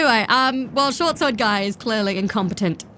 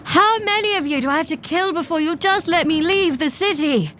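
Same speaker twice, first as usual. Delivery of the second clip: exasperated in-character outcry; 7 semitones higher than usual. Pretend. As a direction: cry out in theatrical exasperation. Player cries out in character.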